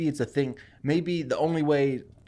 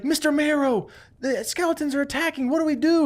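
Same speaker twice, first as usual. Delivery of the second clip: high, frantic voice of a scared woman calling for help; panicked woman; 13 semitones higher than usual. Acted. She cries to the skeleton for help, high and frantic. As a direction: squeak it out in alarm.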